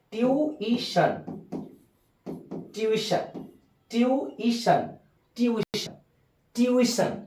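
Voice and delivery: ट्यूशन split slowly and softly into tyoo-i-shun; broken-up pronunciation. Shown as a demonstration. Teacher drilling students syllable by syllable on the word. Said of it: "छोटा छोटा बांट दे".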